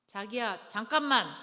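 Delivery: loud, rising call of wait; honey, wait a second, called out loud; calling a halt. Shown as a demonstration. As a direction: stop the fight with a loud wait. Calm stop to angry spouse.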